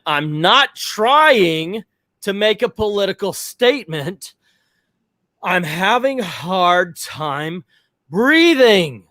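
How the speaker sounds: pleading to be understood, half-reenacted; exasperated plea. To guest service staff, insisting he can't breathe, exasperated.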